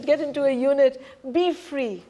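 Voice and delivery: ordinary talk, nothing marked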